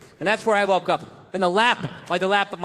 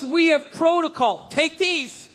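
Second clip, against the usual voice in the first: imitating the trainer; 8 semitones higher, slower than usual. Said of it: "He goes".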